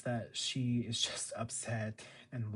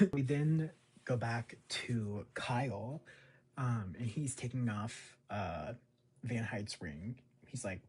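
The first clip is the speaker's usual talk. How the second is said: ordinary talk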